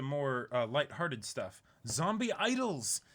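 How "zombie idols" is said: hyped announcement. Acted. Host announces the next anime segment, hyped. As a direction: sell the segment title like a show opener.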